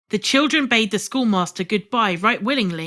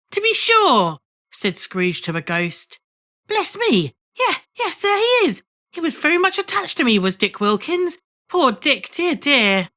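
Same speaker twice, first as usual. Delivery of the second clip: excited; 7 semitones higher than usual. Acted. Scrooge delighted at seeing Dick.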